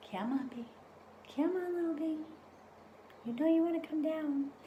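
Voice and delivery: high, sing-song coaxing. Real, not acted. Coaxing a bee down.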